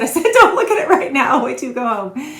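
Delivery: playfully scolding, telling her to wait; mock insistent principal. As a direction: principal insisting she wait until home, a hammed-up order. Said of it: "she said".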